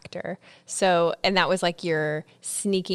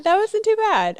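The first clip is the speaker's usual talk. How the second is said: high, louder amused American accent verdict; still laughing; 14 semitones higher, 7 dB louder than usual. Real, not acted. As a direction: tease through laughter. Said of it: "Too much, too much".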